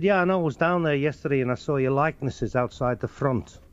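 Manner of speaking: chatty Scouse local; Liverpool accent. Acted. Liverpool man telling him about the theatre front, Scouse accent. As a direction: put on a Liverpudlian. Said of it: "They have their own way of talking".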